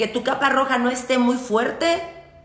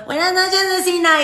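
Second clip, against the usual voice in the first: much higher and louder polite greeting; neighbors' greeting; 9 semitones higher, 4 dB louder than usual. Retold or imitated. Neighbors greet the witch out with her plate, high polite chirp. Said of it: "mis vecinos".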